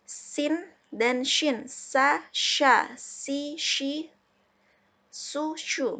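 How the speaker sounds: sin and shin said very slowly, s sharp against sh; teacher drilling the s and sh sounds; sin versus shin drill. Shown as a demonstration. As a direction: drill sa sha si shi su shu apart. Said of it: "Beda ya".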